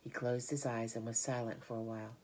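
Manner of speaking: plain reading voice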